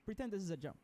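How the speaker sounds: ordinary delivery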